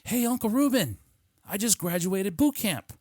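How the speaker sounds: high-pitched imitation of a niece; excited niece. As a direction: chirp proud news like a young recruit. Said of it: "And she's like".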